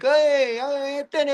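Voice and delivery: high, drawn-out hey; mock Italian-American greeting. People hear he's Italian, mock Italian greeting. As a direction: imitate people putting on an Italian act. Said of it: "they start acting like me".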